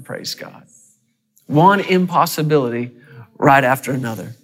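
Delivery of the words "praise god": breathy whisper of praise. Real, not acted. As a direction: breathe out praise God softly.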